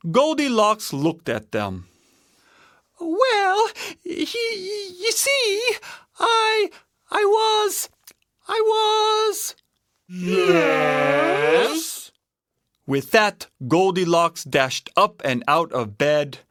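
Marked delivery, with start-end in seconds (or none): 3.0-9.6 put-on very high, hesitant little-girl voice; stammering Goldilocks. A narrator's reading. Frightened Goldilocks stammers an excuse. 10.1-12.1 high, drawn-out bear chorus question; expectant bears. A narrator's reading. Bears press her together.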